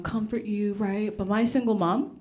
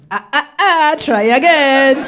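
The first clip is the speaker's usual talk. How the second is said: louder, drawn-out teasing "ah, ah, ah"; Balinese guy teasing; 5 semitones higher, 9 dB louder than usual. Retold or imitated. Balinese man correcting the comic, in loud slow teasing. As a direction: wag a finger with your voice, slowed on "try again". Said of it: "And he goes".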